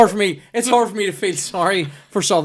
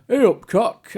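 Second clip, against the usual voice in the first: high-pitched mock Yorkshire greeting; Yorkshire accent; 9 semitones higher, much slower than usual. Shown as a demonstration. Demonstrating an English greeting for friends, Yorkshire accent. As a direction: show off a broad Yorkshire accent. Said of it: "I can do a bit of a".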